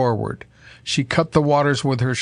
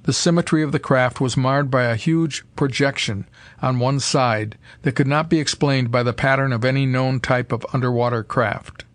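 usual speaking voice

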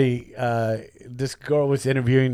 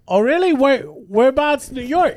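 mock eager grilling; 12 semitones higher, 4 dB louder than usual. Mock-eager questioning of fake cop.

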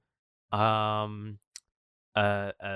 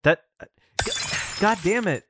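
frustrated swearing; 10 semitones higher, 6 dB louder, faster than usual. Acted. Dropped potatoes, swears.

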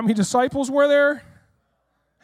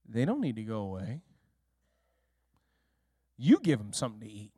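deep quiet Jesus voice; 11 semitones lower, 8 dB quieter, faster than usual. Acted.

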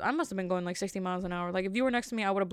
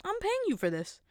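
high, put-on indignant voice; mock outraged I'm paying you; indignant retort; 11 semitones higher than usual. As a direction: snap back like an offended customer. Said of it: "And I was like".

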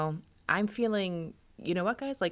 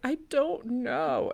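slow, higher, a touch of a sob; mild tearful; 8 semitones higher, much slower than usual. Retold or imitated. Daughter answering her mother between sobs, slow and tearful. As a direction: lightly imitate a daughter crying, slowly. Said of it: "between sobs".

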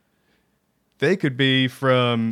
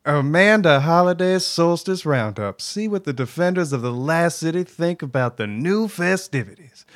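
put-on southern accent; 3 semitones higher than usual. Host reading the solstice roundup as Amanda Holliday, southern accent. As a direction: put on a southern twang for the announcement. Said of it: "like, a southern accent".

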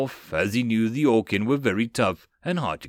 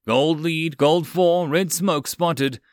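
loud, urgent call over crackling radio; 5 semitones higher, 3 dB louder, slower than usual. A narrator's reading. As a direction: shout it through the comms. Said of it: "his comms crackled".

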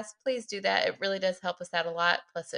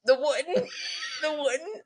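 laughing mimicry; 10 semitones higher than usual. Real, not acted. Mocking the accent, laughing.

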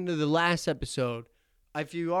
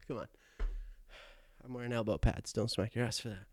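ordinary talk, nothing marked

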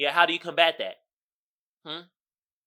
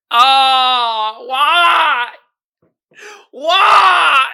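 loud, high comic crying; drawn-out fake sobbing lament; mock wail; 7 semitones higher, 9 dB louder, much slower than usual. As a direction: wail it in hammed-up despair. Mock despair at being awkward.

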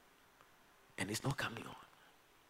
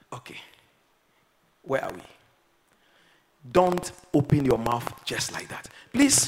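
ordinary delivery

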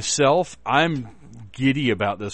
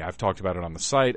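ordinary talk, nothing marked

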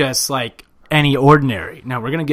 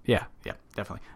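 mumbled aside; 3 semitones lower, 12 dB quieter, much faster than usual. Real, not acted. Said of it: "Doctor Seuss?".

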